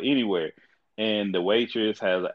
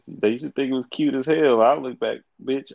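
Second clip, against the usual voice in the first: ordinary delivery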